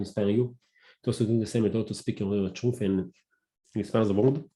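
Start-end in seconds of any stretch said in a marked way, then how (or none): none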